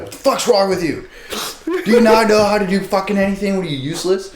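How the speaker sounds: high, hammed-up outrage; mock angry berating. Imagined HR guy berating an employee, mock fury.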